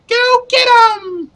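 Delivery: very high, slow squeaky yell; halfling voice. Pretend. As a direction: squeak it like a tiny hero. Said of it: "you're so small that it was more like".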